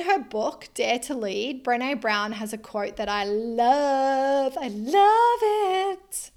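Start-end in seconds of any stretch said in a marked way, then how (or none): 3.2-6.3 high breathy, slow delight; gushing. Real, not acted. Gushing over a quote.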